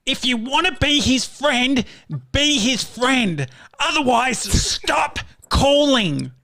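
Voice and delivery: high, slow, drawn-out wail of a furious mum; Mum's angry warning. Retold or imitated. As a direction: ham up an outraged mum, high and stretched out. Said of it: "she said".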